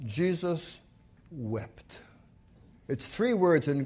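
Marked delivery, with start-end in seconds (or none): 0.1-2.0 soft, falling to near silence; solemn hush. Acted. Preacher reads the shortest verse, hushed and falling. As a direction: let the verse die away.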